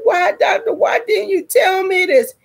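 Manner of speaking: high-pitched tearful mock wail; crying, retold. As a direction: plead with the doctor in a high wail. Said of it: "and I was crying".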